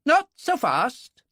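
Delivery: slow, high and firm, a clipped officer's warning; stern military man. Acted. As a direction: hold each word, commanding. Military man stops the croupier.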